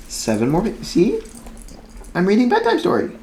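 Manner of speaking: high, cooing sing-song to a puppy. Real, not acted. Talks to her dog mid-reading.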